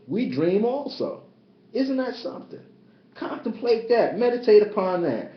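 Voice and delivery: fervent spiritual preaching; impassioned sermon voice. Acted.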